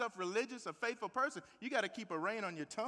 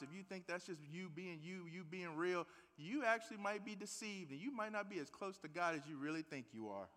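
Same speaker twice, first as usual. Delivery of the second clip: low, quiet, earnest preaching; hushed sermon warning; 5 semitones lower, 8 dB quieter than usual. Acted. Pastor warns the church about their tongues, hushed and grave. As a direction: draw them in with a grave hush.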